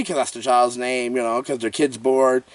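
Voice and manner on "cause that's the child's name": usual speaking voice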